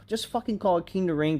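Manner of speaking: normal talking voice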